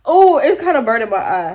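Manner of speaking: usual speaking voice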